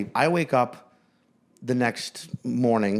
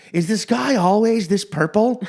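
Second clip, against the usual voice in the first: high, loud, slow wondering question; hammed-up wonder, pitched way up; mock amazement; 10 semitones higher, 6 dB louder than usual. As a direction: imitate a naive, astonished passenger. Acting out Kate's amazed question to attendant.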